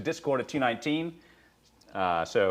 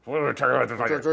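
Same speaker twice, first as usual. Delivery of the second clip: louder teasing repeat; loud echo; 3 semitones higher, 7 dB louder than usual. Pretend. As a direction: blurt the aside out loud. Echoing the muttered line aloud.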